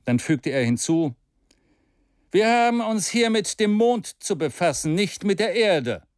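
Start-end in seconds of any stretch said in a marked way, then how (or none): 2.3-6.0 plain, decided statement; mildly stern stranger; clear firm tone. Acted. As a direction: insist on the point. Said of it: "in demselben klaren und bestimmten Ton".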